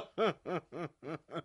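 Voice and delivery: short teasing chuckle; mocking laugh. Mayor laughing at the baker, mocking chuckle. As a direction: laugh at him sarcastically. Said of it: "تمسخر و طعنه".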